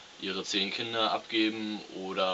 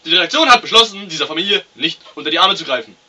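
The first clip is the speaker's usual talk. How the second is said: loud, much higher, fast announcer; dramatic announcer; 11 semitones higher, 21 dB louder than usual. Acted. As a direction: boom out the closing verdict.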